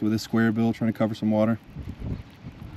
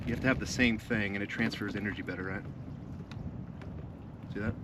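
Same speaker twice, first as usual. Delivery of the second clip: hushed showman; 10 dB quieter than usual. Pretend. Hushed mock magic trick.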